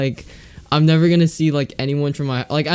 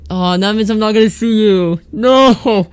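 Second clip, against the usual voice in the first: mock dismay; 8 semitones higher, 7 dB louder, slower than usual. Mock dismay at friend leaving.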